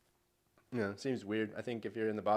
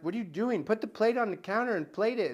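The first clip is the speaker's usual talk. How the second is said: higher-pitched imitation of an exasperated judge; annoyed scolding; 9 semitones higher, 6 dB louder than usual. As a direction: scold a clumsy cook, imitating her annoyance. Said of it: "she got so annoyed and so mad".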